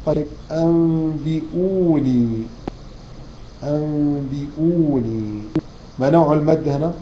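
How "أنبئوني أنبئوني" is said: أنبئوني drawn out in chanted recitation; slow recitation. Acted. Recites the word.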